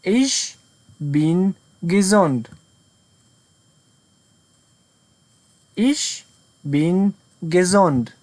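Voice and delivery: each word laid out slowly; very slow model sentence. Shown as a demonstration. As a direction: read it out slowly for beginners. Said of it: "model sentence for learners".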